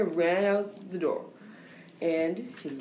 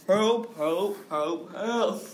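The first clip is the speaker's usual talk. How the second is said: policeman calling help; 3 semitones higher, much slower than usual. Acted. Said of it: "the policeman was screaming".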